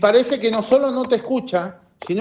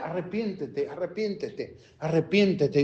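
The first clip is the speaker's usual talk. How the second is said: softer, droning imitation of a preacher; mocking street preacher; 4 semitones lower, 8 dB quieter than usual. Pastor mocking preachers who only say repent, in a soft droning imitation. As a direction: mimic the preachers who just repeat "repent". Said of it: "Estos predicadores estaban todo el día".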